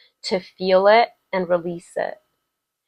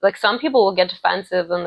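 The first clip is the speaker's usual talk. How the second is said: usual speaking voice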